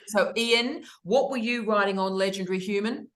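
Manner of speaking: ordinary talk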